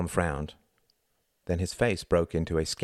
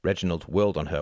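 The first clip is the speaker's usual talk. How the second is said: ordinary delivery, nothing marked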